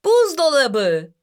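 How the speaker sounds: high, drawn-out exclamation; shouted answer. A narrator's reading. Two children shouting the answer together, excitedly. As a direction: call it out high and slow, with a mild shout. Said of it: "aynı anda bağırdılar".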